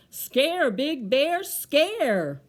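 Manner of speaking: punchy, projected cry; loud storybook call. Acted. Bear thinks Tree said scare, called out loud. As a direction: call it out loudly in rhythm.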